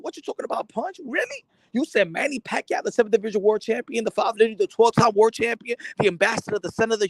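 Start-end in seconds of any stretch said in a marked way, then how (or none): 0.0-1.4 incredulous. Real, not acted. Incredulous at the claim.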